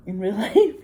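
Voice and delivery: chuckling repeat of in real life, life rising; laughing. Real, not acted.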